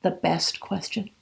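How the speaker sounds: usual speaking voice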